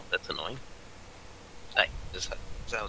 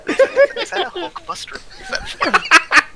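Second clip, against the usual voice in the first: squeaky high voice; 14 dB louder, much slower than usual. Acted. Character reacts in squeaky voice.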